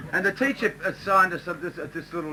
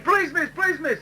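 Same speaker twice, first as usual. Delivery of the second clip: mock keen schoolboy; eager kid; 9 semitones higher than usual. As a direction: beg to be picked, arm waving. Johnny screaming out.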